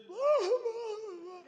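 long, high-pitched imitated wail; mock incoherent wail. Leaders looking at the preacher, making no sense, mock wailing. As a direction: imitate someone overcome, wailing without words. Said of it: "they making no sense at all".

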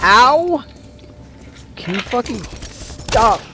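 high, loud angry yelp; pained shout. Real, not acted. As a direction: yell at the bot. Shot by sentry bot.

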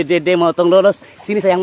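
ordinary talk

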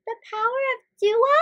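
high, quick, rising query; puzzled question. A narrator's reading. Malaika queries the boss's advice, asking with surprise. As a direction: echo it back as a question. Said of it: "asked Malaika".

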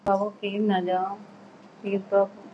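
soft, subdued begging; hushed plea to a partner to stay; quiet pleading. Real, not acted. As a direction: beg gently under your voice. Wife begs husband not to leave.

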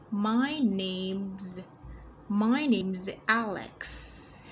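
very slow, drawn-out model sentence; careful teacher's pronunciation, slowly; read-along model. Shown as a demonstration. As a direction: stretch each word for learners to follow.

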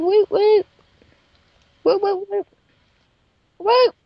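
high, loud woot; excited cheer. Real, not acted. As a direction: cheer the win. Cheering at forty-nine beef.

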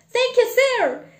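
high, loud grateful cry; delighted boy. Acted. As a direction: shout your thanks as an excited boy.

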